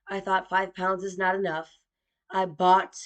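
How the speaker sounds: ordinary talk, nothing marked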